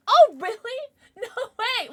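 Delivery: high, loud excited squeal. Real, not acted. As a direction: squeal with delight. Excited reaction to chat.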